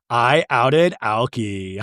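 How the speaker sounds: slow, drawn-out quip with a mock laugh; self-amused gloating. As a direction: imitate someone tickled by their own joke, then cackle. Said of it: "And then Lisa's smiling, going".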